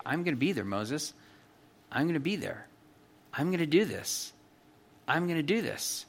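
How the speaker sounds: quiet, tender assurance to Moses; reassuring God. Acted. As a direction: say it softly, like steadying a frightened friend.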